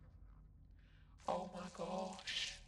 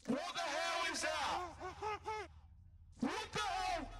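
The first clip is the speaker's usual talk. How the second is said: scared horror game streamer; 9 semitones higher, slower than usual. Real, not acted.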